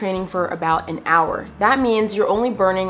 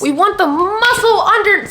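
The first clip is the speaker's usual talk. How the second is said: sudden excited jump; 11 semitones higher, 6 dB louder than usual. Real, not acted. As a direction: pitch leaps high and loud with a laugh. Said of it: "I don't know why I jumped like that".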